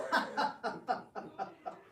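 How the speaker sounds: breathy scheming chuckle; sly villain laugh. Acted. Schemer chuckling to his ally, sly laugh.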